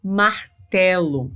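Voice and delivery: "Martelo" split slowly into mar-telo; slow teacher's demo of "Martelo"; sounded-out word. Shown as a demonstration. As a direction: say "Martelo" slowly, each consonant distinct. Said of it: "você tem o som do M".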